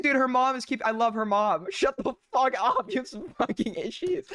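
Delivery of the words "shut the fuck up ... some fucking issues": a light mock of the mom telling her daughter off; imitating the mom. Streamer parroting the mom yelling at her daughter, mock scolding. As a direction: parrot the mom's scolding, half-serious. Repeating the mom's yelled lines.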